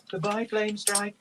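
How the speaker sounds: put-on high-pitched children's farewell; wistful calling out. A narrator's reading. Children leaving their dragon, calling out wistfully. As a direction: call goodbye wistfully in a small child's voice.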